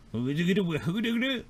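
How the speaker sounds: high carefree hummed tune; the trader humming as he reaches for the panel. Acted. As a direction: hum idly while walking over. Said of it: "He's like".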